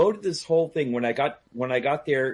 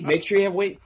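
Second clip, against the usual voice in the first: higher, insistent pestering; mock nagging; 7 semitones higher, 4 dB louder, faster than usual. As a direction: imitate someone nagging yet another time. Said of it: "she bugged me for weeks".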